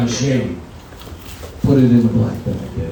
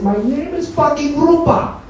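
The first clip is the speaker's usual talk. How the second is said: high-pitched mock indignation; snapped correction; 13 semitones higher than usual. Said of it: "she snapped that fucking day".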